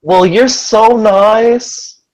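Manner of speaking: sweet Lucy. Acted. Lucy gushes at Arthur with a big smile, high and slow. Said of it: "she smiles even bigger and she says".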